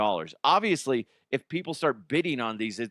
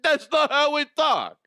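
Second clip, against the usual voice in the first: much higher, louder mock New Jersey protest; mock Jersey local; 5 dB louder than usual. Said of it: "If you're from Jersey, you're just like".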